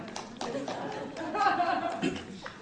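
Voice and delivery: soft breathy laugh; a short nervous giggle. Real, not acted. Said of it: "Are you laughing?".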